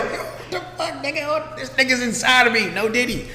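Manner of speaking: high, soft squeal of happy shock; giddy high-pitched amazement, held down in volume; giddy disbelief. Retold or imitated. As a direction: act out being thrilled, small high voice. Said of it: "I was, like, happy as f".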